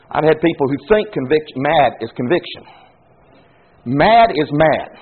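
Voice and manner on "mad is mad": Mad is mad, each word drawn out very slowly; slow, weighted words. Shown as a demonstration. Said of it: "And conviction is conviction".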